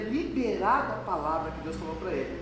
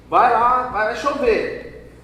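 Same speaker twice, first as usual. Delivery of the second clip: commanding call; 3 semitones higher, 10 dB louder, much slower than usual. Acted. God commanding Elijah.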